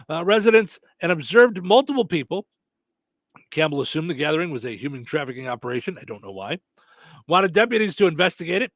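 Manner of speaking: ordinary delivery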